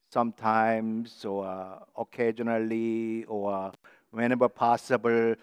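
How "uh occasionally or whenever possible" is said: ordinary delivery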